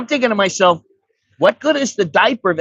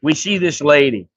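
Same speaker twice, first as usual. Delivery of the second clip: usual speaking voice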